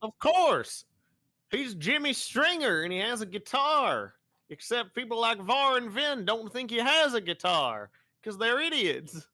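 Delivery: high, loud, worked-up rant; fired up over a fan debate; excited. Real, not acted.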